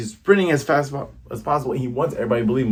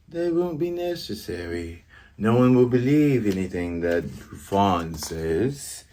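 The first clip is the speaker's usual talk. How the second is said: calm villain voice; 2 semitones lower, 4 dB quieter, slower than usual. Acted. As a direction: speak softly like a scheming butler. Voicing villain Kuro's calm reply.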